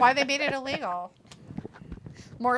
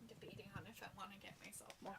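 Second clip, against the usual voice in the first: fast whisper to herself; whispered aside; 29 dB quieter, much faster than usual. Real, not acted. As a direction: rush it out under her breath. Muttering aside while leaving.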